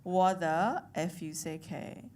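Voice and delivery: "F U C K" said slowly, letter by letter; careful slow spelling of "F U C K"; spelled-out curse. Retold or imitated. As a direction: spell out "F U C K" instead of saying the word. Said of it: "he spelled it out for his baby".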